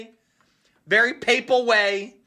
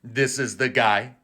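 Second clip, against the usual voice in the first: low, flat deadpan; dry low disdain; unimpressed Pope; 9 semitones lower than usual. Retold or imitated. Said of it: "The Pope's like".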